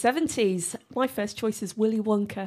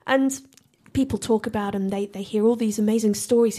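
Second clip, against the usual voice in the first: ordinary delivery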